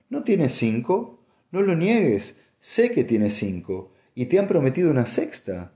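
high-pitched, insistent challenge; heated. A narrator's reading. Wife challenges her husband about the ships, heated. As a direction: press the accusation, pitch leaping up. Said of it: "repitió ella acalorada".

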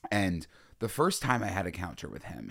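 ordinary talk, nothing marked

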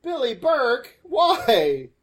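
loud, high plea; anguished shout. Real, not acted. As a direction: cry out in mock despair. Crying out at the movie.

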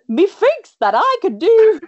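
very high, loud, slow mock Irish; bad Irish accent. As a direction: ham up a terrible Irish accent. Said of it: "it really got really bad".

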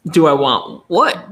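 a touch of a puzzled dog voice; dog asking what; voicing the dog. Voicing the dog's confusion.

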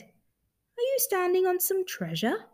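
high-pitched small bear question; hopeful Little Bear. Acted. Little Bear asks the creature about treasure, high hopeful voice.

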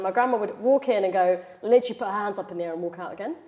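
ordinary talk

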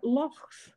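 "lochs" with throaty Scottish ch; "lochs", ch scraped at the back of the throat; Scottish pronunciation. Shown as a demonstration. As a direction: say "lochs" with raspy fricative before the s. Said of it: "you don't talk about lakes".

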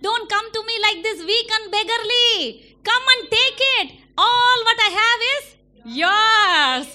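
rising to a loud, high plea; loving father calling out. Acted. Father calls his child to come take everything, loudly. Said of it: "God is like".

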